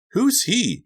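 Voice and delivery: put-on very high, loud child's demand; demanding little boy. A narrator's reading. Pajama-clad Donnie spots a stranger, high demanding child voice. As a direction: demand it in a small boy's voice. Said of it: "he demanded".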